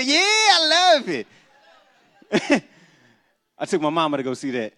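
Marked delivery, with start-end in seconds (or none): 0.0-1.3 much higher, louder, slow squeal; high delighted squeal. Retold or imitated. Mother gushing about the movie, high and slow.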